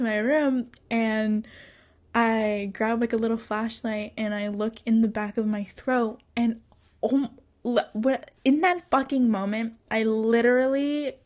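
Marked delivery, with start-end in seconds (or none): none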